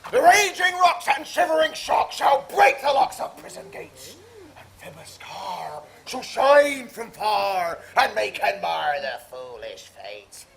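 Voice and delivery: bombastic Ercles rant; ranting tyrant. Acted. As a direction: tear a cat in, full ham. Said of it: "to make all split".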